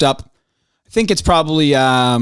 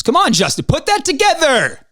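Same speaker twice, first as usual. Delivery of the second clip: excited pleading call, much higher; 11 semitones higher than usual. Real, not acted. Host urging Justin to line up a guest, excited call. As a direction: cheer him on to make it happen.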